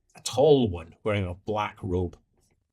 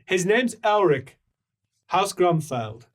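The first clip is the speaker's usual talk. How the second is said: raised, higher declaring voice; formal announcement; 6 semitones higher, 3 dB louder than usual. Acted. Introducing Elric of House Grumfeld, mildly proclaiming. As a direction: announce name and house firmly.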